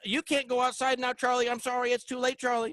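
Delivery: high, sweet talking-to-a-pet voice; mock baby talk to a dog owner's pet Charlie. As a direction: coo at your little dog like he's a baby. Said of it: "you say".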